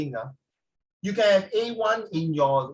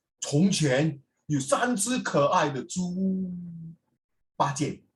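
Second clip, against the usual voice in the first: slow storytelling; slower than usual. Shown as a demonstration.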